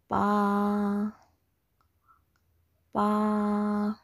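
"Ma" and "Pa" each held long and even; high level tone. Shown as a demonstration. Teacher drills examples three times, high level tone. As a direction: sustain every "Ma" and "Pa" on one pitch. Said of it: "Here I have some example".

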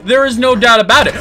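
loud, high boast; triumphant shout. Real, not acted. Gamer boasting while hiding. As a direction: shout it proudly.

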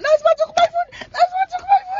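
put-on very high, loud child's shout; imitating a screaming kid. Mother mimicking a child shouting from the back seat, high wail. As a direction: wail it like a kid whose food was snatched. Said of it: "one of these kids screaming back there".

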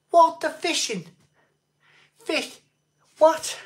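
high, loud squeak of disbelief; can't believe what he's reading; incredulous. Real, not acted. Incredulous at fishing-channel boxing news.

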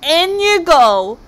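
high, loud, slow bellow; monster roar. Acted. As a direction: roar it as you drop your prey in. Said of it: "roared the Kraken".

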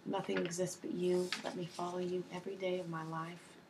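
usual speaking voice

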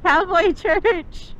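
high, slow, laughing delight; excited. Real, not acted. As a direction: stretch it out, giggling. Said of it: "I got so excited about cowboy church".

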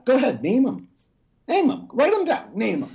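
high-pitched goading demand; goading, voice jumping up high; mock challenge. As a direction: dare them in a high, insistent voice. Mock challenge to name greedy rich.

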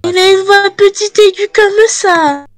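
tiny drone. Shown as a demonstration. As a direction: squeak it out like a tiny high voice. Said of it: "est-ce que tu m'aimerais imiter une dernière fois".

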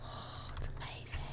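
whispered wonder; awed whisper. Real, not acted. Player marvelling at the game scenery, whispered. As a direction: whisper it under your breath. Awed aside while playing.

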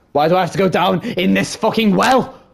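panicking about going down the well; scared. Real, not acted. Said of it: "I am absolutely shitting myself".

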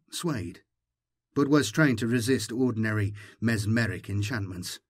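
ordinary delivery, nothing marked

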